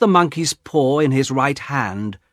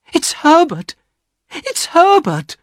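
very high-pitched mother's cry; excited cry; 4 dB louder than usual. A narrator's reading. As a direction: cry it out with wild hope. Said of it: "she cried".

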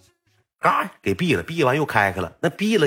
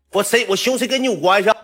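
put-on drunk angry retort; 11 semitones higher, 4 dB louder than usual. Drunk Yong yells back.

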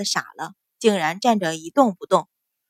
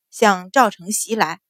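plain reading voice

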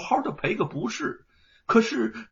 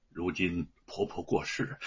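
ordinary delivery, nothing marked

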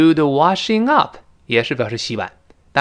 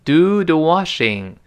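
慢慢示范 Do the washing; slow model reading; 3 semitones higher, slower than usual. Shown as a demonstration. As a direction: Do the washing read slowly and clearly. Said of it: "也有另外其他的表达". (放慢示范 Do the washing)

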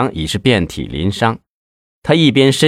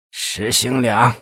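hoarse voice; 2 semitones higher, 5 dB quieter, much slower than usual. A narrator's reading. Suspect answers the interrogator in a hoarse voice. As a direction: rasp out the name quietly, slowly. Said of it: "一个沙哑的声音".

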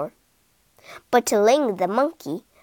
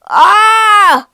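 loud high scream of pain; long, high, loud "aah"; burnt scream; 8 semitones higher, 13 dB louder than usual. A narrator's reading. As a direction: scream as if your mouth burns. Hippo burnt by fire water.

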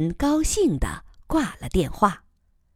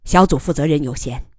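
curt; 3 semitones lower, faster than usual. Acted. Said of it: "简短地说".

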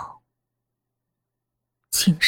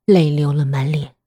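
ordinary delivery, nothing marked

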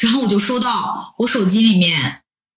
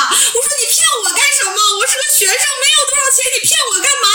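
high, crying plea to the scammer; retold tearful pleading; 9 semitones higher than usual. Student begging the phone scammer for her savings, wailing. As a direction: beg him through tears, voice way up. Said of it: "连哭带嚎的".

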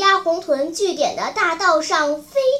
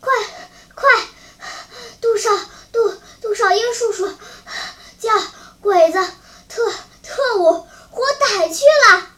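gasping out urgent news, high and loud; broken, panting words between gulps of air; too winded to finish a phrase; out of breath; 6 semitones higher, 5 dB louder, faster than usual. A narrator's reading. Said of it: "上气不接下气地说".

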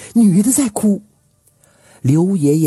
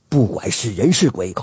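ordinary delivery, nothing marked

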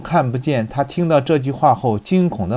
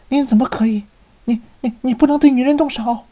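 high, fast stammering outrage; outraged protest; 12 semitones higher, faster than usual. A narrator's reading. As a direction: cry out in indignation. Milady outraged, then softens.

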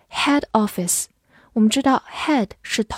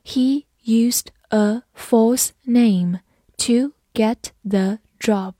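slow, deliberate read; slow classroom model sentence; slower than usual. Shown as a demonstration. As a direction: stretch every word for learners. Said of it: "好，我们慢读一遍。".

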